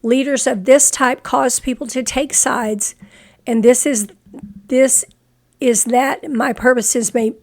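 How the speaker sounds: ordinary talk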